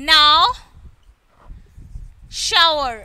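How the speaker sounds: Now and shower with the "ow" glide; OW as in how. Shown as a demonstration. Teacher drilling the how column, OW demo. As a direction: model Now, shower slowly spaced, both like how. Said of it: "how എന്ന രീതിയിലാണല്ലേ".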